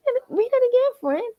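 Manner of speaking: high, excited call to reread; excited urging. Real, not acted. Host urging chat to reread the letter, excited. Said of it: "I want y'all to read that again".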